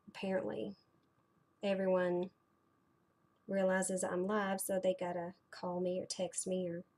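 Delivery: normal talking voice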